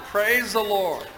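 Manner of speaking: high, loud, slow exclamation; exultant praise. Acted. Preacher echoing the joke's old lady, exultant praise. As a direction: call out praise over the laughter. Repeats the lady's cry after laughter.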